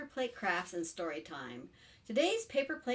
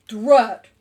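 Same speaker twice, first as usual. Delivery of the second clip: loud, abrupt exclamation; frustrated chameleon; 18 dB louder than usual. Acted. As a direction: let out one loud word of frustration. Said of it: "said Napoleon".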